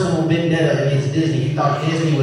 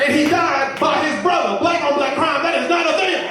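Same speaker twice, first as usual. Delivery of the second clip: mock angry dad; 8 semitones higher than usual. Dad ranting continues.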